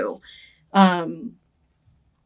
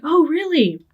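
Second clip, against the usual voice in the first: higher mock boy voice, surprised; a lightly voiced boy perking up; boy's surprised reply; 9 semitones higher, 3 dB louder than usual. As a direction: play the boy caught off guard. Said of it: "And he's like".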